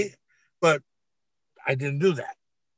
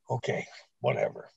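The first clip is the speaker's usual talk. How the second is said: breathy, lower, softer brush-off; dismissive mutter; 7 semitones lower, 9 dB quieter than usual. Retold or imitated. Telling the other wrestlers, half-voiced and dismissive. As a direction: shrug it off under the breath.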